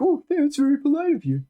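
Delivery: high, coy in-character thanks; mock polite reply. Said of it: "you're like".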